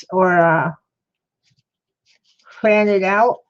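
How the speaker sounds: talking around a paintbrush; words muffled by a brush in mouth; mouth full. Real, not acted. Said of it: "paintbrush in the mouth".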